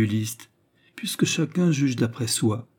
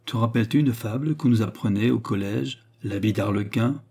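ordinary delivery

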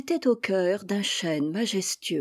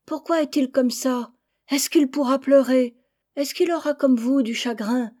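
put-on higher, louder child questions; curious chicks; 5 semitones higher, 4 dB louder than usual. Chicks ask their parents about the little one, high curious questions. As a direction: innocent kids asking hard questions.

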